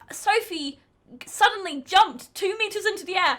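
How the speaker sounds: ordinary delivery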